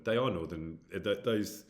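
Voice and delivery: normal talking voice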